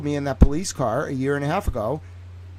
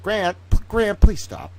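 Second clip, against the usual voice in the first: rising, louder, fed-up pleading; exasperated plea; 10 semitones higher, 6 dB louder than usual. Acted. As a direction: beg him to stop, getting shriller. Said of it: "but also be like".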